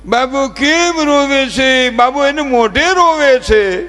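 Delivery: mother fussing over her crying son, slow high cooing; doting mother. Retold or imitated. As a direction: coo over the crying baby like a fussing mom. Doting mother voiced in a story.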